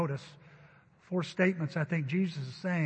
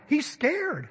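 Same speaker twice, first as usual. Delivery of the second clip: high, loud mock alarm; alarmed wife; 10 semitones higher, 5 dB louder than usual. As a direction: play the worried wife, voice shooting up then dropping. Wife alarmed about crying baby.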